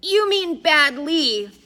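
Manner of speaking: high, loud, drawn-out correction; pedantic correction. A narrator's reading. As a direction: stretch it out to correct his grammar. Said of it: "Addison corrected".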